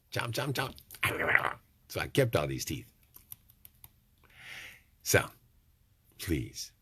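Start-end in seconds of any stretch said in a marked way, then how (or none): 0.1-1.5 chomp, chomp, chomp spoken very slowly like biting; drawn-out playful chewing sounds; slow mock chomping. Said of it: "chew some of those trolls up".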